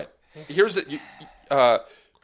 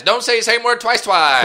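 loud teasing; 9 semitones higher, 6 dB louder than usual. Real, not acted. Teasing correction, high and loud.

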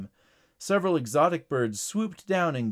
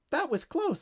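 high-pitched, relieved bird voice; little bird. Acted. Bird just saved from the alligator, relieved. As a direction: speak high, as a tiny bird. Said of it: "said the bird".

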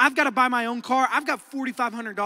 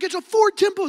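higher-pitched eager announcement; excited; 8 semitones higher, 3 dB louder than usual. Retold or imitated. Teen proudly naming his car to classmates, excited. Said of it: "am so excited".

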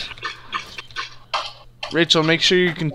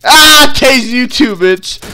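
loud, higher, slow taunt; mock taser yell; 8 semitones higher, 9 dB louder, slower than usual. Suspect tasing the trainee back, loud. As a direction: yell it like you're zapping back.

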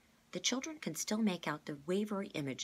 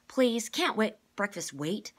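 high, loud pleading; eager interruption; 7 semitones higher, 8 dB louder, slower than usual. A narrator's reading. Kate interrupts Mister Benedict, begging loudly. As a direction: cut in, too eager to wait. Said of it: "Kate interrupted".

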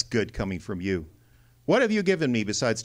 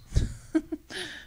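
sardonic laugh; 3 dB quieter than usual. Acted. Spade mocks the meeting plan.

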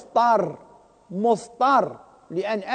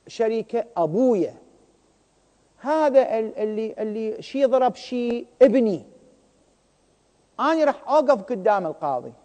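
ordinary delivery

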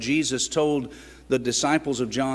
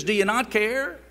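fearful disciples; 8 semitones higher than usual. Retold or imitated. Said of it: "fear caused them to say to Jesus".

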